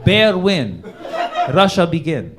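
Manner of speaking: broken English, loud and laughing; a hammed-up Russian storyteller; Russian accent narration. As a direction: pitch a mock Russian screenplay. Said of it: "writes a full-on screenplay".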